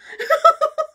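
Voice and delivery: high, loud giggle burst; giddy laugh. Real, not acted. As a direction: giggle with glee. Giddy over highlighter.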